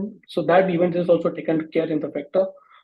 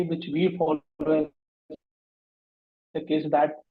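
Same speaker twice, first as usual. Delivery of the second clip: choppy, cutting-out audio; breaking up connection; 4 dB quieter than usual. Real, not acted. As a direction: voice dropping out on a bad line. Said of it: "Yes, your voice is breaking".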